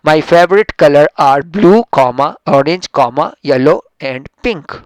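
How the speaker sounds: very slow, loud, each item spaced; slow dictation. Shown as a demonstration. Teacher reading a sentence aloud to students, slow and loud. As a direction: spell out the list slowly for the class. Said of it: "number one".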